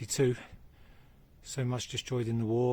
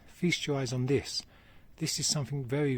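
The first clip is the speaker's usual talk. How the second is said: ordinary delivery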